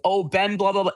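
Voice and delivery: high-pitched, put-on fawning imitation; mock gushing flattery. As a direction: imitate someone laying on fake praise. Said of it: "he was trying to glaze me. It's so performative".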